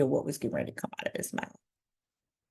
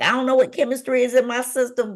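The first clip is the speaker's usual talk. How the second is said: higher, louder and fast burst of elation; excited retelling; 6 semitones higher, 6 dB louder, much faster than usual. Retold or imitated. Young woman delighted to be pregnant, excited. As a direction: gush quickly with raised pitch.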